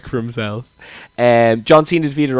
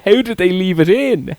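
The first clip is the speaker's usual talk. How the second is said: retold scream; 7 semitones higher, slower than usual. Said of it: "I started screaming".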